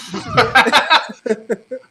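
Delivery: loud, high burst of laughter; a loud burst of real laughter; big laugh. Real, not acted. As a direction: crack up out loud. Host cracks up at clip.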